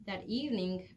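ordinary delivery, nothing marked

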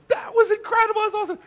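put-on very high thrilled child's cry; son's high, gleeful shout; excited kid. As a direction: squeal like a delighted boy. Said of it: "total other reaction of".

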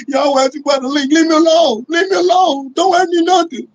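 high, loud mocking whine of a crybaby; whiny imitation. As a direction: mimic a crybaby streamer whining.

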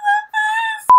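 high laughing disbelief; shocked squeal. Real, not acted. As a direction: squeal at the weird face. Shocked laugh at idol's face.

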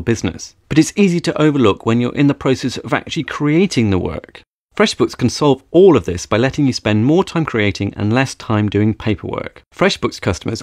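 smooth sponsor read, steady and upbeat. Acted. Podcast host reading a sponsor message, polished ad read. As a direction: sell the product like a radio spot.

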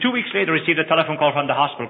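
ordinary talk

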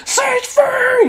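loud, high echoing shout. Retold or imitated. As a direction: yell the name across the hall. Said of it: "and I turn around and it's".

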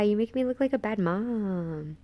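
ordinary talk, nothing marked

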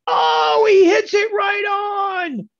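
high, drawn-out shout like a commentator; mock triumphant cheer. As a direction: yell it as a hammed-up victory call. Mock commentator cheer at correct price.

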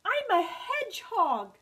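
high, loud, proud reveal; announcing. A narrator's reading. Hedgehog steps into the light, announcing herself. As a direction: proclaim it brightly at full voice. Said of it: "Barb announced".

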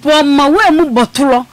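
real heated protest, pitched high; heated argument. Real, not acted. Heated on-air argument, demanding to speak.

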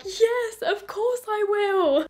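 high, slow, elated acceptance; overjoyed yes. Retold or imitated. Accepting the proposal on the beach, high elated yes. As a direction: say yes as if overwhelmed with joy. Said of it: "straight away I was like".

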